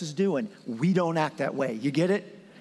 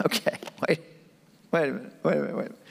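mock exasperated; much faster than usual. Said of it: "Jesus was hacked off".

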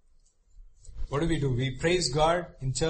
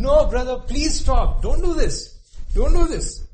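high, loud desperate pleading; Tamar pleading; 10 semitones higher, 9 dB louder than usual. Retold or imitated. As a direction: beg your brother to stop, voice climbing higher. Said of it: "Tamar is pleading with him".

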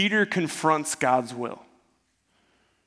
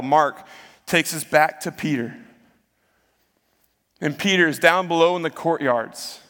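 normal talking voice

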